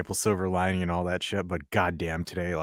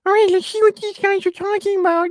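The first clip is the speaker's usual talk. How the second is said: high, loud nerdy voice full of eager anticipation; Star Wars geek; 9 dB louder than usual. Pretend. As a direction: play a keen fan tuning in. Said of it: "some Star Wars geek just like".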